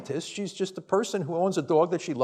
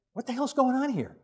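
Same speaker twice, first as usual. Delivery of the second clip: exasperated; 6 semitones higher, 4 dB quieter than usual. Real, not acted. Exasperated at sugar in clinics.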